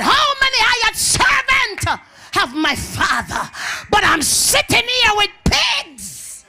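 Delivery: high, loud preaching outcry; drawn-out, full-voiced dramatic yell; prodigal's anguished shout. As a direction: cry it out as the prodigal. Acts out prodigal son's outcry.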